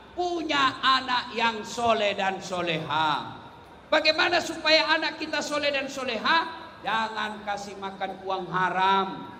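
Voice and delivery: ordinary talk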